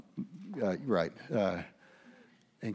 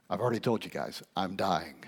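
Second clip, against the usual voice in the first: ordinary delivery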